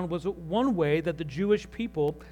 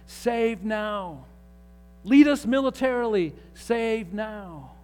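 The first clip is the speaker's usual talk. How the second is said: higher, louder, rising sermon fervour; preaching at full force; 4 semitones higher, 4 dB louder than usual. Acted. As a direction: preach it, building the cry of the crowd. Preaching builds over crowd's cries.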